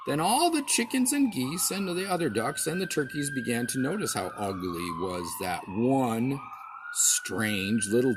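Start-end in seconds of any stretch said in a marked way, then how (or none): none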